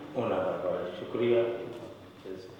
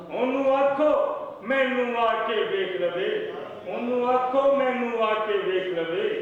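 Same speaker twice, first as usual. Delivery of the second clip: high-pitched declaimed Punjabi verse; poetry recital; 4 dB louder than usual. Acted. Poet reciting couplets at a mushaira, declaimed recital. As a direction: recite phrase by phrase, repeating lines for the audience.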